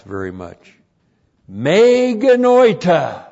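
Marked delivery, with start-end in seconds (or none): 1.5-3.3 loud, high, drawn-out declaration; slow, forceful outcry of denial; emphatic. Shown as a demonstration. Preacher declaring Paul's favourite Greek phrase, emphatic. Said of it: "strongest negative in the Greek".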